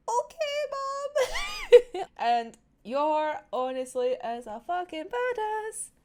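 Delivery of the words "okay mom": put-on high, loud childlike voice; Anya's reply. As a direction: squeak it like a little girl. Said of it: "Anya's just looking at her like".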